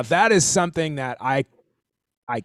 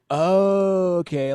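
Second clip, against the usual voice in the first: long, raised, louder "Oh" settling into "okay"; dawning realisation; 7 semitones higher, 5 dB louder, much slower than usual. Retold or imitated. Teacher retelling how the sheet music clicked, drawn-out realisation. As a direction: re-enact the penny dropping with a stretched "Oh". Acting out his moment of realisation.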